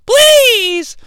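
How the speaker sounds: high falsetto pleading; begging woman. Retold or imitated. Liz begging.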